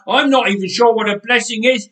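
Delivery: loud, fast, high protest; indignant rant. A narrator's reading. Nathan protesting to the helpers, loud and indignant. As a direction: refuse to budge, full of outrage.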